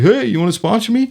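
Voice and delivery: mock sweet request, pitch up; light hopeful voice asking a favour; asking a stranger to be his sponsor, in a mock sweet high voice; sheepish high ask. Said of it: "I was like".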